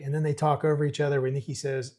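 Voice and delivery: usual speaking voice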